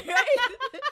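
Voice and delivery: a high, loud burst of laughter; laughing. Real, not acted. Host laughing with her cohost, loud and high. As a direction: laugh it out, then ask right.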